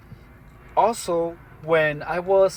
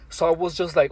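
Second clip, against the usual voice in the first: usual speaking voice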